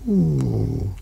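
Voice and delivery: usual speaking voice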